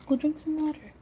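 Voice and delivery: unvoiced aside to the dog; whisper. Real, not acted. Telling her dog to go drink water, whispered. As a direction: hush it under your breath.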